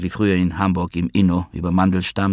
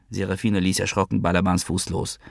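ordinary delivery

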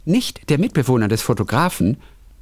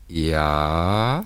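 a man's voice on the intercom; 7 semitones lower than usual. Acted. Man answering intercom in read story.

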